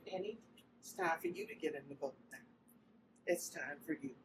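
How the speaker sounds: low, soft, hushed inner voice; soft whisper. Retold or imitated. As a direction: quietly urge yourself onward. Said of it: "to whisper to me and say".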